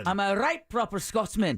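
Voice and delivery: put-on Groundskeeper Willie Scottish accent; a hammy Scottish accent as Willie. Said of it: "how [name]'s Scottish is".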